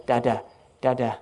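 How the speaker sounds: a lightly voiced toddler's "Da, da, da"; toddler babble. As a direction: babble like a small child wanting dad. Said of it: "tug at my hand".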